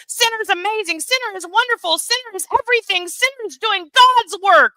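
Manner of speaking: high, gushing fangirl voice; mock gushing fan. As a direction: gush over him like a starstruck fan. Mocking fawning chat fans.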